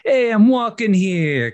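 high, slow, loud New York "walking here"; hammed-up New York street accent. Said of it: "kind of accent".

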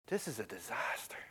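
soft, low, whispery dismay; hushed despair. Retold or imitated. Preacher voices disciples after the death, hushed despair. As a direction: let all hope drain into a near whisper.

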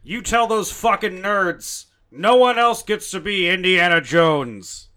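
defiant bellow at the studio; loud Harrison Ford. Pretend. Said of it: "you have to do Harrison Ford loud".

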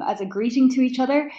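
ordinary talk, nothing marked

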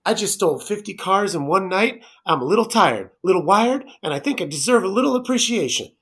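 mock Nick Cage. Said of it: "number two".

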